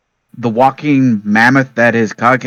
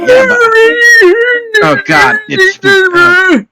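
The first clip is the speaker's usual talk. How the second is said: high startled squeak; high surprised; 6 dB louder, much slower than usual. Real, not acted. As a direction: squeal in shock. Reacts to shuffling old man.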